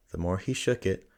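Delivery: usual speaking voice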